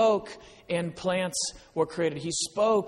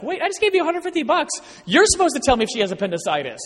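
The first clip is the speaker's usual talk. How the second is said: high, fast, indignant complaint; indignant protest; 9 semitones higher, 6 dB louder, faster than usual. Retold or imitated. Retelling his protest to the doctor, fast and indignant. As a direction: sputter it out in disbelief.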